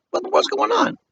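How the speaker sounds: sudden high startled voice; startled Leonard. A narrator's reading. Leonard confused by the scene, high and startled. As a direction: jump up high in surprise. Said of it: "Leonard's like".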